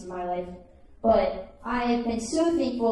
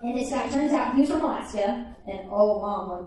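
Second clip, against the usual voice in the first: ordinary delivery, nothing marked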